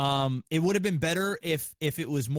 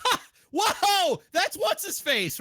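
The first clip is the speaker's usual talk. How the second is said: high, loud startled "whoa" from the crowd; mock audience gasp; 13 semitones higher, 3 dB louder, slower than usual. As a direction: play the stunned theater crowd. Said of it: "They're like".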